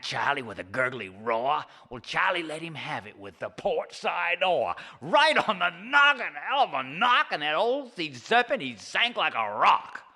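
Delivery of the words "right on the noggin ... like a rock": higher, louder, gleeful telling; lively storyteller climax; excited narration. A narrator's reading. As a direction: relish the knockout.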